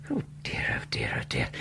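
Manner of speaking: breathless whispered oh dear, oh dear; dismayed whisper. Acted. Doctor James whispers, dismayed at the soaked parcel.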